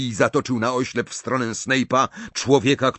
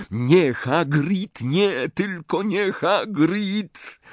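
higher, drawn-out plea; anguished plea; 6 semitones higher, much slower than usual. A narrator's reading. Harry's inner voice dreading for Hagrid, anguished plea. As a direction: dread rising with each repeat, pitch climbing.

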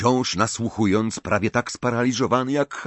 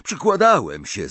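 high, indignant retort; stung young man's angry comeback; angry cry; 7 semitones higher, 4 dB louder than usual. A narrator's reading. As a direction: protest angrily, pitch jumping up. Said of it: "zawołał ze złością Malfoy".

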